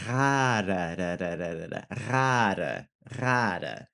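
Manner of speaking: "rara" slowed, its second R repeated as "da, da"; second-R tap. Shown as a demonstration. Teacher isolates the second R, tapped "rara, da, da". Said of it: "aiming for the second R".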